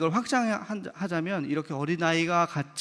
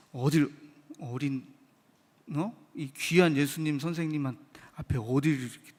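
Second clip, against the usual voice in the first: ordinary talk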